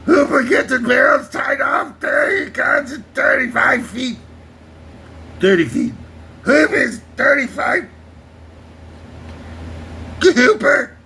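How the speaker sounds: gruff shouted sea-captain impression; Quint from Jaws. Quint yelling orders at Hooper on the boat, shouted impression. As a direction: bark it like Quint yelling over the water. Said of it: "my Quint is getting much better".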